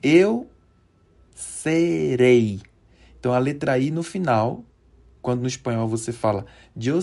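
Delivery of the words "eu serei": eu high and loud, then serei firm; loud drill. Shown as a demonstration. Teacher modelling the future tense, loud separated model. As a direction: pause after eu, then land serei. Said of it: "o futuro simples você fala".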